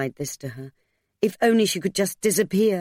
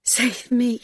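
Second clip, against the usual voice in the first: higher-pitched, urgent begging; desperate plea; 7 semitones higher than usual. A narrator's reading. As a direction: beg to be rescued. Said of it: "He was past holding back now".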